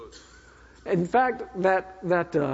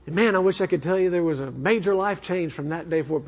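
ordinary delivery

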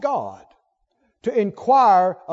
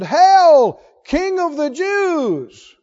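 loud, drawn-out mock homage, pitched high; 5 semitones higher, 6 dB louder, much slower than usual. Soldiers mocking Jesus as king, mocking. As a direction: hail a king you are making fun of. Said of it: "They're mocking him".

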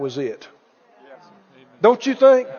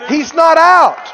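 loud, raised, drawn-out preaching cry; emphatic shout; 6 semitones higher, 6 dB louder, slower than usual. Real, not acted. Preacher urging the congregation that Peter still belongs, emphatic shout. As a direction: drive it home loudly, stretching the words. Repeats it forcefully to rouse congregation.

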